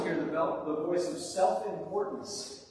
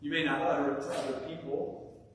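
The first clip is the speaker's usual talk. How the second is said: ordinary delivery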